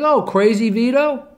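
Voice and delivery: high, slow, drawn-out "Oh" of surprise; like she just placed the name; surprised recognition. Retold or imitated. Waitress recognizes the nickname.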